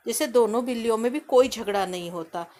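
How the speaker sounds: usual speaking voice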